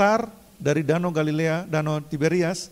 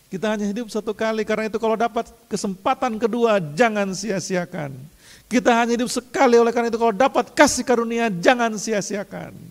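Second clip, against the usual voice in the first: high, louder urgent sermon push; preaching at full force; 8 semitones higher, 4 dB louder than usual. Acted. Pastor urging the congregation not to waste grace, full preaching. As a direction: drive it home to the congregation.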